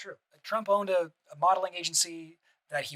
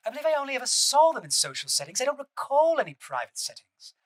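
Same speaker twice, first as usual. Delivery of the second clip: higher, mild imitation of a British accent; 8 semitones higher than usual. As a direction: Ghislaine Maxwell's British accent, lightly done. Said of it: "my British accent".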